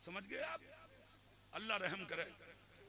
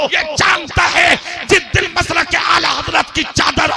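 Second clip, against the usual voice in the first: much louder and higher, roared; full-force preaching; 8 semitones higher, 26 dB louder than usual. Acted. Preacher warning the gathering about the false pir, roaring sermon. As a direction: hammer the phrase out to the crowd.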